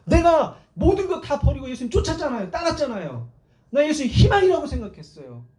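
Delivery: aggrieved, pleading argument; indignant remonstration. Acted. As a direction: argue back like a betrayed follower. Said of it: "따지는 거예요".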